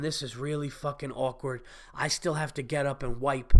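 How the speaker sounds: low, soft aside to yourself; awkward inner thought. Retold or imitated. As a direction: voice your embarrassed thought quietly, head down. Acted inner thought, head down.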